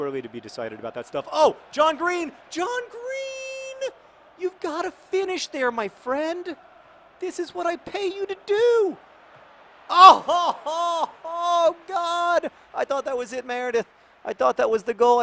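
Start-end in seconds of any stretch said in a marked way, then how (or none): 1.3-3.9 excited shout. Real, not acted. Cheering his footballer in FIFA. 4.4-15.2 excited yelling. Real, not acted. Yelling at the game.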